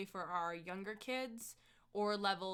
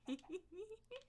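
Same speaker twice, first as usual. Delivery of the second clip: high, soft giggle; 10 semitones higher, 10 dB quieter than usual. Real, not acted. Streamer laughing at own line about father, quiet high giggle. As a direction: giggle softly, high in pitch.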